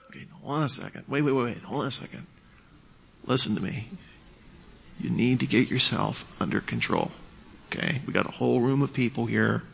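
soft, controlled de-escalation; hushed but firm calming reply. Shown as a demonstration. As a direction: bring the volume right down and settle them. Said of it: "you get quieter".

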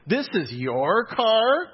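slow, high, disbelieving "This is your car?" with a long rise on "car"; incredulous question. Retold or imitated. Professor sizing up the student's car, slow incredulous question. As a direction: imitate her drawn-out disbelief. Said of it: "She said it just like that".

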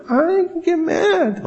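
put-on high, small child's reply; squeaky pretend child; little kid voice. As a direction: answer like a shy little kid. Voicing a little kid answering.

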